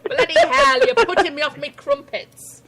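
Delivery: "bloody hell" shot up high and loud, then exaggerated British; hammed-up Brit. Joking about British words, hammed-up Brit. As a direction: play an over-the-top Brit.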